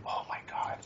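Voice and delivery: breathy whispered oh my God; whispered dismay. Retold or imitated. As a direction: whisper it in disbelief. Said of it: "and you're like".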